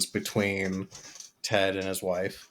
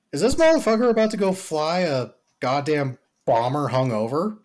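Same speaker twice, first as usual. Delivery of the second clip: incredulous; 6 semitones higher, 5 dB louder than usual. Retold or imitated. His own disbelief retold.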